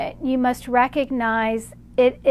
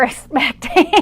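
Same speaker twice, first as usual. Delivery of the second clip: word breaking into a laugh; amused, tipping into laughter; 2 semitones higher, 4 dB louder than usual. Real, not acted. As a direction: crack up as you finish. Amused at the unexpected answer.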